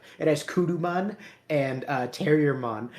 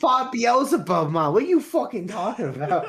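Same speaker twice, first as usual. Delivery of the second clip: outraged rebuttal; 9 semitones higher, 5 dB louder than usual. Real, not acted. Correcting friend about best fight, high loud indignation. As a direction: blast it back in mock outrage.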